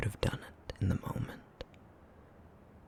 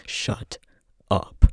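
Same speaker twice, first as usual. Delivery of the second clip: angry shout; 12 dB louder than usual. Acted. Vampire losing his temper, shouting at the detective. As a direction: whisper then burst into a loud shout. Snaps at listener mid-confession.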